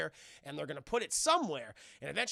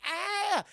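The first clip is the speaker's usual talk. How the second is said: mock pained yell; 13 semitones higher, 7 dB louder than usual. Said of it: "He's like".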